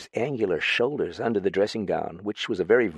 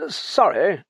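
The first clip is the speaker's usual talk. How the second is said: a touch of lofty tipsy dignity, pitched up; mildly pompous drunk apology; slightly raised, grandly dignified drunk; drunken dignity; 9 semitones higher, 4 dB louder than usual. Acted. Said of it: "in a voice of immense dignity".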